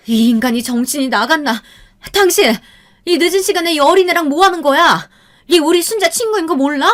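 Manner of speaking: high, loud furious shouting; furious wife. A narrator's reading. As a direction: scream at the cheating husband. Mother grabs father's collar.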